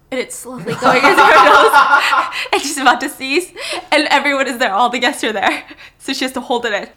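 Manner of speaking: can't keep it together; laughing. Real, not acted. Speaker cracking up at story.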